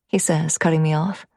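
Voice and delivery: usual speaking voice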